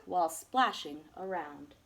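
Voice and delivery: ordinary delivery, nothing marked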